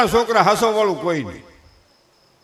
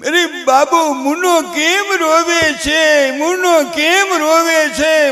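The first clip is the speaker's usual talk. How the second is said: high, stretched mock cooing; slow, high sing-song fretting; fussing over baby; 7 semitones higher, 4 dB louder, much slower than usual. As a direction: wail over the baby with exaggerated concern. Family fussing over crying child, acted.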